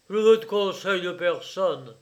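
angry rebuff. A narrator's reading. Father rejecting his son's advice, angry rebuff. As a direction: refuse it forcefully.

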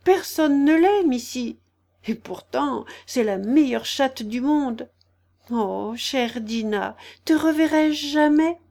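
a touch of tearful longing; lonely storybook girl missing a pet; sad. A narrator's reading. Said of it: "se dit-elle tristement".

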